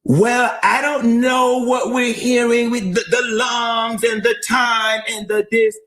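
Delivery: high-pitched mimicry of doubters; mock reluctance. Host mimicking doubters of the audio, mock reluctant. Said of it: "this reluctance".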